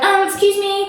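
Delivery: high-pitched interrupting objection, imitated; piping up. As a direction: pipe up in mock officious protest. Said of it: "that's when the lady fucking pipes up".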